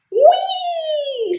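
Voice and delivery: high, delighted whee; fly through the air with joy; gleeful whee. Acted. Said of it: "sang Turtle".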